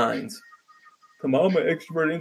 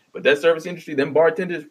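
usual speaking voice

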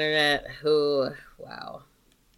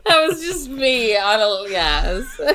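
laughing; 4 semitones higher, 7 dB louder, slower than usual. Real, not acted. Laughing about her window moment.

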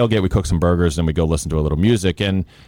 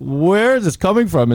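high, bemused where-did-this-come-from; baffled surprise; 12 semitones higher than usual. Retold or imitated. Retelling a stranger's praise, mock baffled surprise. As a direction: pitch it up in happy disbelief. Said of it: "And I'm like".

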